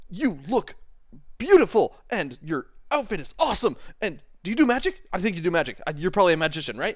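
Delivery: high, eager flattering rush; starstruck gushing. Acted. Swain complimenting Thea, high and starstruck. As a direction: flatter her like a giddy fan. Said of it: "he goes".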